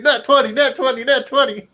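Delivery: laughing, high-pitched excitement; excited retelling. Retold or imitated. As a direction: mimic a player crowing over lucky rolls. Retelling a player's repeated lucky rolls.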